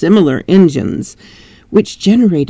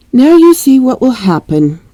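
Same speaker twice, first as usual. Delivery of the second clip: high, complaining housekeeper; 7 semitones higher than usual. Acted. Old housekeeper complains to her master, high and whiny. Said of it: "continued the old housekeeper complainingly".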